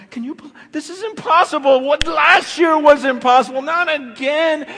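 high, loud, amazed cry; mock amazed shout. Preacher mimics amazed people, loud high shout. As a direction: shout it like you can't believe it. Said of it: "walking around going".

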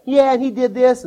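lightly voiced office gossip; gossiping coworker.

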